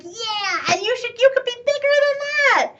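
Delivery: eager encouraging peer. Retold or imitated. Peers pushing you to aim higher.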